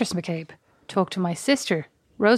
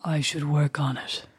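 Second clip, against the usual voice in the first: low, slow, softer would-be sexy voice; creepy sexy voice; 6 semitones lower, 4 dB quieter, slower than usual. Shown as a demonstration. Sister trying out a deeper voice, creepy sexy voice. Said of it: "just talking in that creepy voice".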